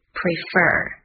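Prefer with the weight on the second syllable fer; stress on fer. Shown as a demonstration. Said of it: "在fer 这个位置".

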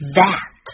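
"that" opened with a buzzing, voiced th; tongue between the teeth, voice the th in "that"; voiced TH demo. Shown as a demonstration. Teacher showing learners the th in "that", carefully voiced. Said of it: "咬舌声带震动".

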